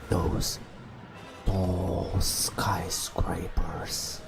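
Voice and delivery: usual speaking voice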